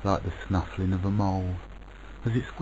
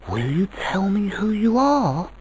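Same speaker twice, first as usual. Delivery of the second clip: put-on high, eager child voice, louder; child asking; 10 semitones higher, 5 dB louder than usual. A narrator's reading. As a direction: ask like a curious child, voice lifted high. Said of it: "asked the child of dreams".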